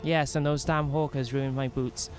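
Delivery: ordinary delivery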